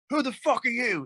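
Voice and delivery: put-on high, rude British accent retort. Rock star to an autograph-seeking fan, high British accent brush-off. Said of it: "in his British accent".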